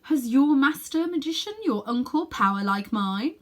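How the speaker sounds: a commanding queen, slowing on the last words; firm queen. Acted. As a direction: demand it firmly, like a ruling queen. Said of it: "asked the Queen firmly".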